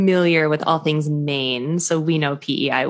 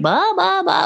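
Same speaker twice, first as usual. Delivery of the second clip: put-on baby babbling; 11 semitones higher, slower than usual.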